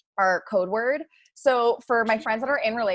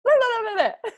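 mock babble; 13 semitones higher than usual. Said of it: "I say".

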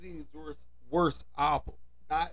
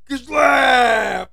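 higher and much louder, a barked slap word; shouted kishlack; 8 semitones higher, 12 dB louder than usual. Acted. Man yells the slap word at an imagined date. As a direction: yell it like the slap lands.

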